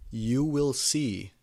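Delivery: drawn out and knowing; mysterious teacher. A narrator's reading. Professor Trelawney teasing the new girl, slow and knowing. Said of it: "she said".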